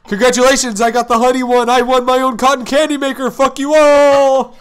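loud, high gloating; gloating shout. Acted. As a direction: crow over the win, slowing down. Sakaki gloats after winning.

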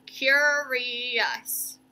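"Curious" drawn out in three connected beats; three syllables. Shown as a demonstration. Teacher introducing a word to count, "Curious" slowly in syllables. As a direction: stretch each syllable of "Curious". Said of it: "I can hear three syllables in that".

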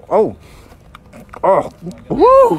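ordinary talk, nothing marked

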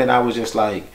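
ordinary talk, nothing marked

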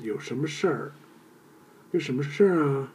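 Beijing-accented 事儿 with a clear R sound; teacher's demo of Beijing R-colouring on 事儿. Shown as a demonstration. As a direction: lean on the Beijing R in 事儿. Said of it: "the R sound".

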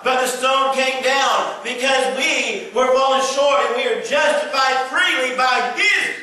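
loud, high shouted preaching. Real, not acted. Pastor to congregation on sin, shouted preaching.

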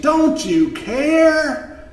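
high, drawn-out desperate cry; storybook shriek of reproach; shrieked plea. A narrator's reading. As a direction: wail it loudly, stretching the words. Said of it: "They shrieked".

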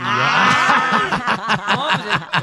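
loud laugh into words; laughing burst. Real, not acted. Guest reacts to the Belinda tattoo joke, laughing loudly. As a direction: burst out laughing, then speak. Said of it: "reacting to Belinda joke".

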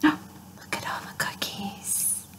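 gasped, whispered in awe; awed whisper. Acted. Pigeon staring at a pile of cookies, whispering. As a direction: breathe it in wonder.

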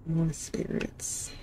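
slow frightened whisper; scared whisper. Real, not acted. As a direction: breathe it out scared. Whispering in fear.

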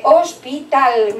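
"hospital" drawn out syllable by syllable; teacher slowly sounding out "hospital"; sounded out slowly. Shown as a demonstration. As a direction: say "hospital" slowly, letting every part be heard. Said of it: "con todas las letras".

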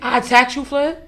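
loud disbelieving I attacked you; incredulous outburst. Real, not acted. To Flip, denying she attacked him, loud and incredulous. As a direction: throw the accusation back in disbelief.

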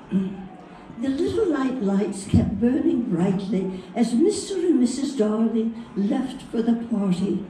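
storybook British accent, unhurried and gentle; English dialect. Shown as a demonstration. Reading Peter Pan to the audience in a mild British accent. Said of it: "in an English dialect".

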